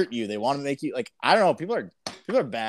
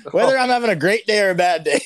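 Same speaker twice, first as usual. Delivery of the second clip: laughing; 8 semitones higher, 8 dB louder than usual. Real, not acted. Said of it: "laughing while speaking".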